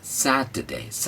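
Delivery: Saturday very slow with Sat lifted; stress on SAT. Shown as a demonstration. Teacher drills Saturday with lifted Sat. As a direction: stretch and lift Sat. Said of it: "इसको उठाना है".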